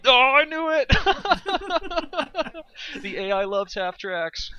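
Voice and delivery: high, loud laughing yell; excited shout. Real, not acted. Caster spotting a half track, shouting with glee. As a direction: burst out laughing in triumph.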